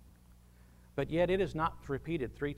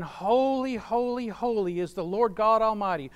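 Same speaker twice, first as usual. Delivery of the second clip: angels crying out; 5 semitones higher, 4 dB louder than usual. Retold or imitated. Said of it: "similar angels are saying".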